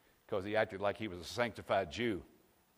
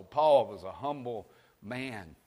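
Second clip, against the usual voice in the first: ordinary talk, nothing marked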